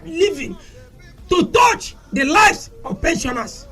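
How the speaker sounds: high-pitched, heated tirade, shouting on key words; impassioned shouting rant. Real, not acted. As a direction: rail against the governors with rising fury. Passionate rant against governors.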